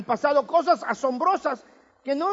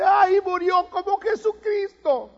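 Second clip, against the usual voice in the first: mother lamenting; 7 semitones higher, 3 dB louder, slower than usual. Retold or imitated. Mother mourns her son.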